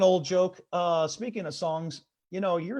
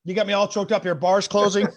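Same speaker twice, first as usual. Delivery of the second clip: ordinary talk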